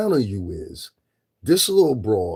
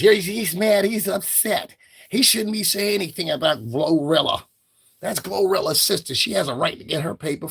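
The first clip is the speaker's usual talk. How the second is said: mock defender voice; 6 semitones higher than usual. Mocking a defender's voice.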